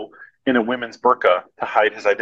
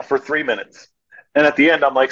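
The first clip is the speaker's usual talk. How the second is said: ordinary delivery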